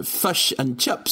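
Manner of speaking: slow New Zealand accent on fish and chips; slow hammy Kiwi accent. Shown as a demonstration. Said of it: "Can you do a New Zealand accent?".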